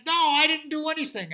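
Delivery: high, loud denial; protesting guard. Acted. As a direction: deny it loudly. Guard denies.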